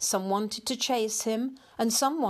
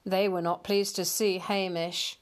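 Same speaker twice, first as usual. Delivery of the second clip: ordinary delivery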